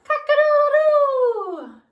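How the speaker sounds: high, loud, drawn-out rooster crow; booming cock-a-doodle-doo. A narrator's reading. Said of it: "crowed the rooster".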